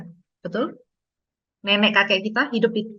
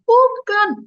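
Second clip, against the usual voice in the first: put-on high, loud little-kid "no"; sulky child; 12 semitones higher, 7 dB louder than usual. Child refusing parent's guess, high and sulky. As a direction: answer like a stubborn small child.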